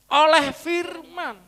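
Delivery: very slow, high stress on Oleh firman; drawn-out emphasis. Acted. Preacher stressing the verse for the congregation, slow and high.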